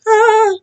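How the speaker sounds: high, loud frazzled squeal; short panicked squeak. Real, not acted. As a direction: let out a sharp yelp of overwhelm. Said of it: "bear with me if I feel like".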